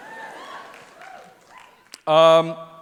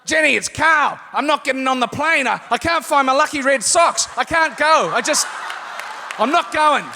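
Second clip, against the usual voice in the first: Vigo posing as Carl; 8 semitones higher than usual. Retold or imitated. Prank caller posing as the actor to his agent, hammed-up high ranting. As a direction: rant in a mock high-pitched panic. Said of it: "Vigo had called Jenny up, woke her up".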